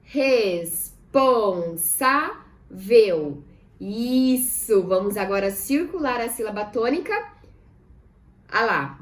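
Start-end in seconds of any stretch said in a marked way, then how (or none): 0.0-3.4 RES, PON, SÁ, VEL, very slow and loud; "Responsável" as res... pon... sá... vel; syllable by syllable. Shown as a demonstration. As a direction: split "Responsável" into four drawn-out syllables. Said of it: "Vamos separar em sílabas".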